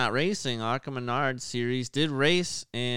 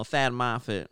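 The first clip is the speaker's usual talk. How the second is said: ordinary talk, nothing marked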